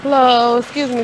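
usual speaking voice

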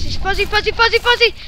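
rising terrified no, no, no; panicked pleading. Real, not acted. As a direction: beg in building panic. Monster near, panic rising.

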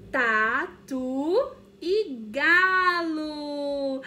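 slow, loud stretched reveal; teacher pretending surprise for kids; mock surprise. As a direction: act amazed at the cards.

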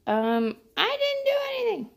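playing innocent to the swatting cat; mock innocent. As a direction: protest like a kid caught out. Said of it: "I'm like".

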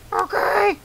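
squeaky falsetto character reply, very high; Freddy. Acted. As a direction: answer in a tiny put-on squeak, straining the throat. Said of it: "I'm gonna keep doing the voice".

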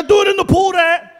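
high, loud exasperated order; insistent dad. Acted. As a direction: shout it across the pool at your child. Dad insisting over son's protest.

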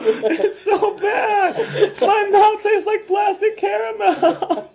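high, slow laughing disgust. Real, not acted. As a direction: laugh helplessly through the words. Tasting awful candy, laughing.

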